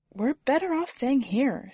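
Susie's voice. A narrator's reading. Susie speaks in story.

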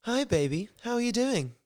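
high, lilting mock Queen's English; Queen's English accent. Lisa phoning Mark in a script read, Queen's English accent. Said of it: "started out as, like, Queen's English".

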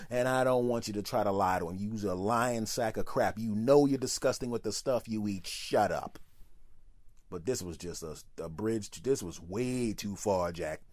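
ordinary talk, nothing marked

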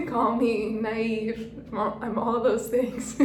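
slow, laughing "call me naive". Real, not acted. Owning her naivety to listeners, laughing. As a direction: laugh through the words at yourself.

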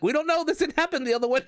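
the friends cracking up, clueless; laughing confusion. Retold or imitated. Friends equally baffled, laughing.

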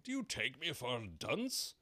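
high, loud sharp king; impatient royal rebuke. A narrator's reading. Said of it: "the king sharply".

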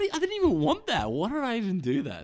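loud, high mock outburst; exasperated self-scolding. Driver regretting the order round the corner, exasperated shout. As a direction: berate yourself at a high pitch.

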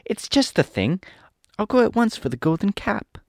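joyful Dorothy. A narrator's reading. Dorothy hears the Scarecrow's monkey plan, high joyful voice. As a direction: exclaim happily as a little girl. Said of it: "said Dorothy joyfully".

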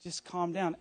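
wife calming her panicking husband, softly; calm reassurance. Retold or imitated. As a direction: talk him down gently. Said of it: "she's like".

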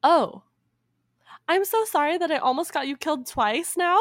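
high, loud, exaggerated apology; mock apologetic princess. Princess apologizing to Aladdin, hammed-up remorse. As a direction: overdo the guilt as the princess. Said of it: "she feels very sad that she put him in harm's way".

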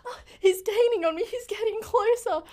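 frantic caller running from a killer; being chased. Acted. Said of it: "he's coming after me".

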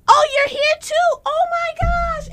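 very high, loud and slow; high, drawn-out excited gush; excited squeal. Retold or imitated. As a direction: squeal with delight at a friend. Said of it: "And I was like".

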